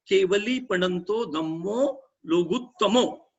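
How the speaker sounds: धीमा, लोगुत्तमो का अंत ओ से; एक एक शब्द धीरे, लोगुत्तमो पर ओ साफ़; सही उच्चारण. Shown as a demonstration. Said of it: "लोगुत्तमा नहीं बोलना है". (लोगुत्तमो, एकवचन ओ के साथ धीरे धीरे)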